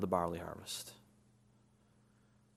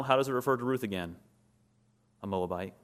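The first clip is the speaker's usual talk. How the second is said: ordinary talk, nothing marked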